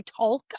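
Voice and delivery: "talk" said as "tolk", with the L; "talk" with a long O before an audible L; L sounded in talk. Shown as a demonstration. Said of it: "instead of T A L K".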